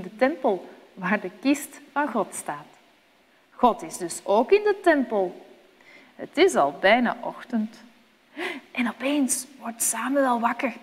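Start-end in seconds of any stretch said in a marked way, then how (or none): none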